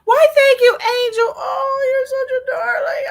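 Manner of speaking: high, loud, over-the-top sweet thanks; hammed-up darling voice, gushing. Thanking a sweet new follower, in a hammed-up gushing voice.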